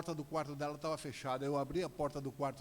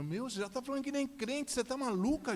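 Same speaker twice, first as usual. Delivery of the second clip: high-pitched, incredulous telling-off; rising outraged father to daughter; exasperated scolding; 10 semitones higher, 3 dB louder than usual. Retold or imitated. Father scolds daughter about church.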